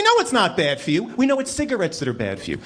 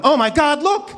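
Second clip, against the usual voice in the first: excited tourist; 7 semitones higher, slower than usual. Retold or imitated. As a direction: squeal it like a starstruck tourist. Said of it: "I'm walking around Houston going".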